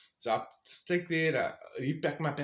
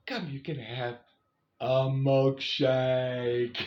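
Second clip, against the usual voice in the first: slowly drawn-out coaxing offer; tempting friend; 5 dB louder, much slower than usual. Retold or imitated. Lanisha coaxes hiker into lunch, slow tempting voice. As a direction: dangle the milkshake like a treat.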